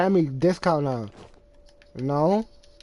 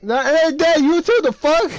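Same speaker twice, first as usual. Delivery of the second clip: loud protest; 9 semitones higher, 5 dB louder than usual. Real, not acted. Heated back-and-forth on a call.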